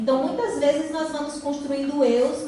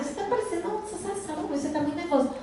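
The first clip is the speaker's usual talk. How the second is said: put-on higher, quick, light child voice; a light, quick, higher little-girl voice telling off her mother; imitating a little girl; 5 semitones higher, 6 dB quieter, much faster than usual. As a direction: mimic the daughter scolding her mom, sing-song and fast. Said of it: "ela foi mostrar".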